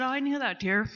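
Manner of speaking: real tears rising while quoting dad; tearful. Real, not acted. Woman in recovery quoting her father, voice breaking. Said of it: "now I'm gonna start crying".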